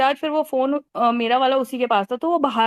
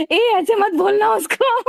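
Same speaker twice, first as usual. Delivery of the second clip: laughing yell; 8 semitones higher than usual. Retold or imitated. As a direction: yell it at him while laughing. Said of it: "और मैं चिल्लाई". (हँसते हुए ऊँची आवाज़ में डाँट)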